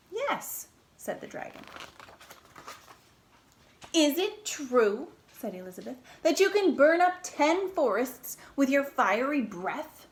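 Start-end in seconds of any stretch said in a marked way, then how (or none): 0.1-0.7 high, proud yes. Acted. Dragon boasting to the princess, proud. 3.9-10.1 a hint of sweet, eager flattery; butter the dragon up; flattering princess. Princess flattering the dragon, lightly sweet.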